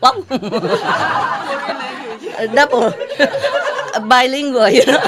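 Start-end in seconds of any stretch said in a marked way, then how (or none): none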